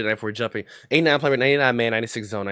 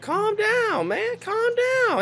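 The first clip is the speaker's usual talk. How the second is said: much higher, mocking sing-song calm down; much slower than usual. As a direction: taunt him in a high squeaky voice. Mocking friend during game argument.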